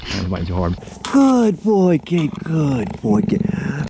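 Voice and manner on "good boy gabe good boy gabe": pet talk. Real, not acted. Praising the cheetah.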